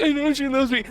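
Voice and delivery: high, excited and smitten; smitten reply. Retold or imitated. Telling Abby he knows the baby loves him, high and thrilled. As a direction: gush back, delighted. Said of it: "and I was like".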